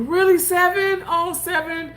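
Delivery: high, incredulous question; surprised by the comment; high disbelieving reply to a viewer. Real, not acted.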